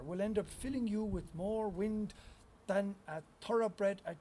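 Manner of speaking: wry, measured poem delivery; performed poem reading; poetry reading. Acted. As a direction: read it out to a listening room with dry wit. Reads a comic poem aloud.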